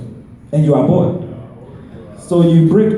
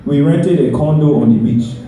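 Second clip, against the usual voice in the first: usual speaking voice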